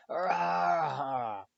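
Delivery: high anguished howl of pain; pained scream. A narrator's reading. Quirrell screaming as Harry grabs his face. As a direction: let out a burning scream.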